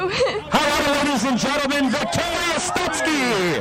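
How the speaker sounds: high, slow ringing crowd call; announcer call. Real, not acted. Announcer presenting the winner to the crowd, name stretched out and ringing. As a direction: stretch her name out for the grandstand.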